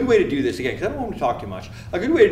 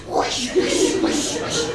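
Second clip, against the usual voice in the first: imitated rushing swooshes, no voice; Matrix-style whoosh; much slower than usual. Medic dodging blood like the Matrix, whooshing noises. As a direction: blow out airy action-movie swooshes. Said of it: "It's like the Matrix".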